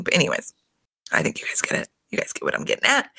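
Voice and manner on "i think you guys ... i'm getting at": whispered aside to the audience; conspiratorial whisper. Real, not acted. Vlogger confiding to her viewers, whispered. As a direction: lean in and whisper knowingly.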